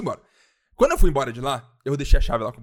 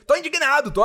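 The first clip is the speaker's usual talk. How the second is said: imitação bem aguda de indignação; Gilberto indignado; 12 semitones higher than usual. Retold or imitated. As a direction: protestar em falsete, com drama exagerado. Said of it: "que nem o Gilberto do B B". (indignação caricata, lá no agudo)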